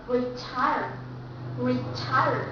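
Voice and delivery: retire with TIRE stressed, true T; retire, lean on TIRE. Shown as a demonstration. Teacher showing second-syllable stress. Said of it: "we stress in the second syllable".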